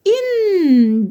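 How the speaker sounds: "إن" as short i with a long held n; tanween kasra sound. Shown as a demonstration. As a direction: draw out "إن" as innn. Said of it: "دي تنوين بالكسر". (صوت تنوين الكسر، "إن" بنون ممدودة)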